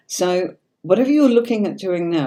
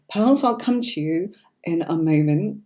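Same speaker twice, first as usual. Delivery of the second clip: ordinary talk, nothing marked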